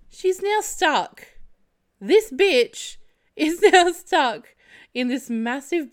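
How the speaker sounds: high, slow words through laughter; real giggling delivery; laughing. Real, not acted. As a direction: laugh while you say it.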